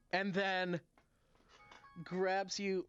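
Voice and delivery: usual speaking voice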